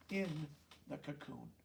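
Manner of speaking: plain reading voice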